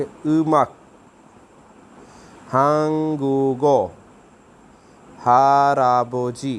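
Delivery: उमक, हांगुगो, हाराबोजी drawn out very slowly and loud; Korean words demo. Shown as a demonstration. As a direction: stretch each Korean word हांगुगो, हाराबोजी. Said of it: "कैसे पढ़ेंगे?". (बहुत धीमा Korean उच्चारण)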